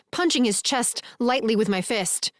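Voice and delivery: ordinary delivery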